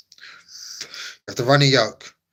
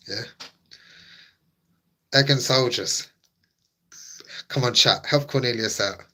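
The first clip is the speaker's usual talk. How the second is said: ordinary delivery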